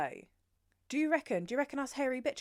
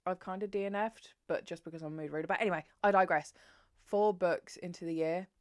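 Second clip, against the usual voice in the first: ordinary talk, nothing marked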